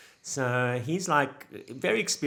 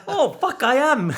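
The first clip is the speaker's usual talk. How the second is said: surprised; 13 semitones higher, 11 dB louder, slower than usual. Retold or imitated. Extra caught out, bursts laughing.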